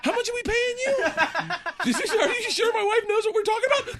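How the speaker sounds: high, laughing mock-suspicious voice; laughing disbelief. Joking with his therapist about his wife, laughing high. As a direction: laugh it out as a giddy joke.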